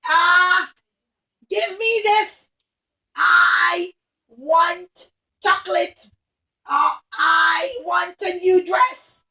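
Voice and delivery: loud, whiny demanding cries, slowed; spoiled whining princess. Acted. Spoiled princess demands chocolate and dresses, whiny. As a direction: whine and demand like a spoiled child. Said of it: "Every time she wanted something, she'd say".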